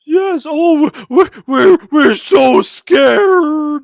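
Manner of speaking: loud, high mock scared, very slow; mock being terrified; hammed-up sarcastic terror; sarcastic fear. Sarcastic reply to miracle claim.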